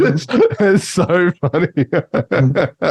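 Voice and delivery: slow, high words through real laughter; can barely get it out for laughing. Real, not acted. Cracking up over the clip.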